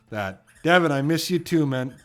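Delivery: ordinary delivery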